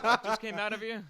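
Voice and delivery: high, loud, fast mock New Yorker with a laugh; laughing New York guy taken aback. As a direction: blurt it out, laughing in the tough voice. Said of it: "And he's like".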